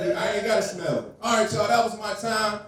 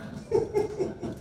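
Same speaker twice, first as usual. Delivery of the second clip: breathy laugh; 13 semitones higher, 7 dB quieter than usual. Real, not acted. Laughing at own cop bit.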